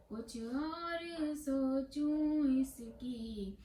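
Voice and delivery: melodic sing-song verse, slowly drawn-out line endings; poem recitation. Acted. Poet reciting her own verse to listeners, lilting recitation. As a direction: chant the poem tunefully, stretching the vowels.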